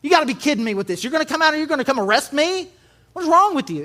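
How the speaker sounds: high, fast, indignant mock rant; mock outburst. Daniel mock-ranting at the guard, high and fast. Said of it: "start going off on him".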